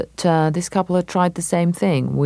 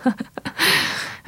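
tinkly fake laugh; 3 dB quieter than usual. [name]'s put-on laugh.

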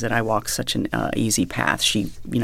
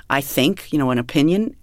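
ordinary delivery

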